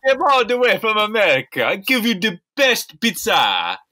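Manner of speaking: hammed-up Italian, stammering a little; mock Italian accent. Acting Italian pizza chef.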